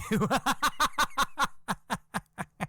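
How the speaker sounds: high breathy gloating laugh; wife's cackle. Retold or imitated. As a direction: cackle in triumph. Wife laughs.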